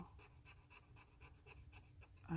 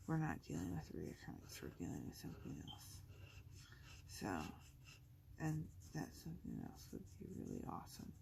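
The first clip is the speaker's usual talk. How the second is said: whispering; 10 semitones lower, 5 dB quieter, faster than usual. Real, not acted. Hopeful whisper about cancer.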